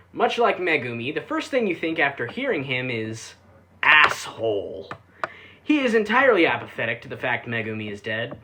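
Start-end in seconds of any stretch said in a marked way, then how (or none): 3.8-4.9 slow, dry, deadpan insult; blunt verdict. Acted. Reviewer voicing what viewers think of Natsuno, slow deadpan. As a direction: let it land slow and flat-out rude.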